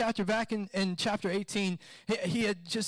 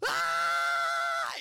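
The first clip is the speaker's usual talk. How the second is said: high-pitched scream imitating a panicked kid; imitated scream. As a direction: mimic a child's sudden shriek. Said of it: "and then starts screaming".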